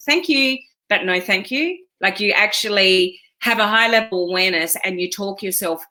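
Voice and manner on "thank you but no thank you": higher-pitched polite refusal; light, dismissive politeness; polite brush-off. Acted. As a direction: decline it sweetly, tune lifting at the end. Politely pushing a thought away.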